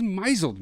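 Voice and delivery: misled voiced as "MY-zuld"; misread as mizzled. Shown as a demonstration. Puzzling over a word, misled said as mizzled. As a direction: say misled like a word rhyming with chiselled. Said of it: "What does misled mean?".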